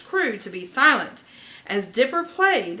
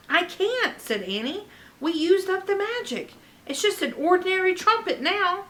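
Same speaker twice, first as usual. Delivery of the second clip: put-on high girl voice; 9 semitones higher than usual. Annie voiced.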